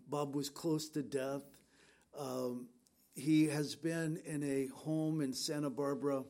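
normal talking voice

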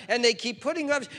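loud, high, driving sermon voice; full-force preaching. Acted. Pastor pressing the congregation about sin, loud preaching.

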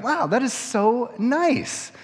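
very high, slow, delighted "Wow"; pleasantly stunned; amazed delight. Retold or imitated. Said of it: "I'm just like".

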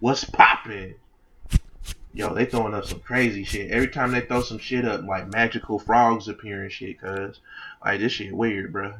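put-on streetwise slang delivery. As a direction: challenge a stranger like a gang member.

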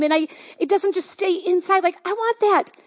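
normal talking voice

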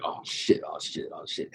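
barely audible nervous whisper; hushed mock panic. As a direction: mutter it under your breath with your head down. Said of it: "heads down".

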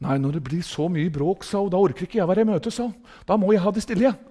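soft, subdued woman's reply, lightly voiced; understated imitation of a timid churchgoer; imitated little sister. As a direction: quote her meekly wanting some quiet. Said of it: "denne lille søstra".

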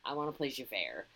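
low, soft aside; private inner thought. Retold or imitated. Twelve-year-old's secret wish about a role, low private aside. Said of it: "always in my head was like".

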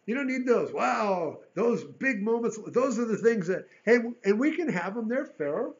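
full-force pulpit voice; preaching loud. Real, not acted. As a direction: build up and proclaim.

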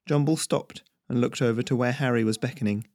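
ordinary delivery

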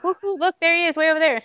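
high mock squeal at a distant celebrity; starstruck excitement. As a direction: replay a fan spotting a star far off. Retells spotting a star from afar.

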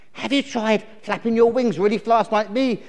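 high bluebottle character voice; perky buzzy fly; helpful fly. Acted. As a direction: suggest it as an eager little insect.

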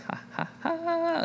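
high, soft gloating laugh, drawn out; playful mock cackle at the people below; smug mocking laugh. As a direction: gloat over the city like a petty villain. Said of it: "I can just picture Jonah".

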